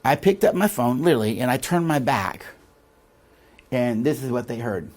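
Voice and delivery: usual speaking voice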